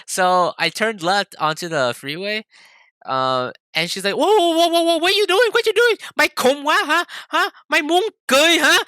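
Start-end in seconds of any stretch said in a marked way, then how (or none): 4.1-8.8 very high, loud alarmed squawking; panicked mom. Retold or imitated. Mom as her son turns onto the freeway, panicked. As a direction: imitate a parent shrieking in alarm from the passenger seat.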